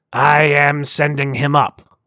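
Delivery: slow heavy alien warning. Acted. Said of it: "Sar warned".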